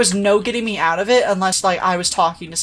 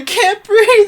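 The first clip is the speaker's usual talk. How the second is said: high, loud mock panic; imitated hyperventilating panic; 12 semitones higher, 5 dB louder, slower than usual. Panicking caller to a friend, hammed-up breathless panic. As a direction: squeal it like someone panicking. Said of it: "again, I'm hyperventilating".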